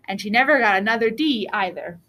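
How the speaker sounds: usual speaking voice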